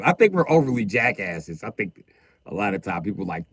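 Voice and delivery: ordinary talk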